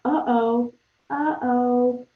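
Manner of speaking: loud mock alarm; shocked uh-oh. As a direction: voice her sudden shock. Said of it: "She's almost, like, in shock".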